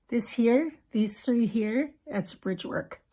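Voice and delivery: ordinary delivery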